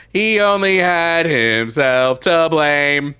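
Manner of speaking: high, loud, slow menacing line; sinister mother. Pretend. Streamer voices the killer mother, slow, high and loud. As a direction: say it like the mother who killed him.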